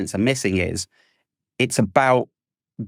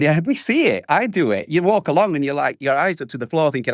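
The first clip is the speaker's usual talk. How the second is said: usual speaking voice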